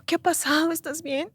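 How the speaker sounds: voz ligeramente actuada, más aguda y suave; tío preocupado, recreado. Retold or imitated. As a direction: preguntar con preocupación cariñosa, en tono alto. Tío pregunta si está bien. (tío preocupado a media voz)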